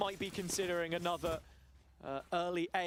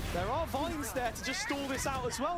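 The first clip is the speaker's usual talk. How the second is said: rising hype; 7 semitones higher than usual. Real, not acted. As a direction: pitch climbs higher as the play builds. Caster hyping a big play.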